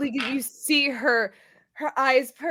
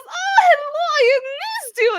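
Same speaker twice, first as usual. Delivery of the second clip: high, effusive hello; gushing greeting; 11 semitones higher, 4 dB louder, slower than usual. Acted. Grendel picking up her parents for a hug, gushing. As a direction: gush as if reunited at last. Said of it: "She goes".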